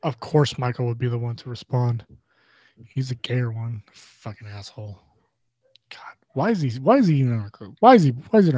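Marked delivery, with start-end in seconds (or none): 0.0-5.0 muttered under breath. Real, not acted. Griping about a friend under breath. 5.9-6.7 whispered complaint. Real, not acted. Griping about a friend under breath.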